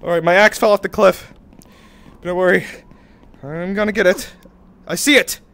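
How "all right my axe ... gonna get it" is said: ordinary delivery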